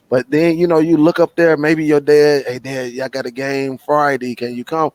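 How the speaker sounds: ordinary delivery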